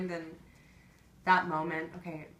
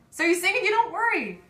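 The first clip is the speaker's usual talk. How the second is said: much higher and louder, insistent refrain; emphatic exclamation; 11 semitones higher, 10 dB louder than usual. Acted. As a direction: belt out the reassurance at high pitch. Refrain repeated as emphatic climax.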